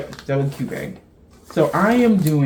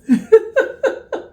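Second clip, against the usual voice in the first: sudden high laugh at her own joke; high burst of laughter; 6 dB louder than usual. Real, not acted. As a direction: let out a squealing laugh.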